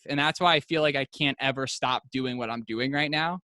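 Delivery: usual speaking voice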